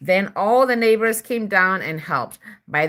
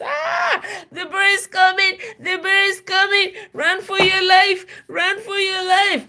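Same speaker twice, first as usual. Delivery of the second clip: put-on panicked kids; 12 semitones higher than usual. Kids in the story flee the bear, high and panicked. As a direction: cry out as if fleeing a bear.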